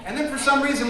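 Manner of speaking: normal talking voice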